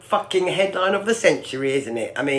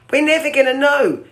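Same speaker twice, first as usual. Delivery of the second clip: raised voice; 8 semitones higher, 3 dB louder than usual. Real, not acted. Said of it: "I'm shouting".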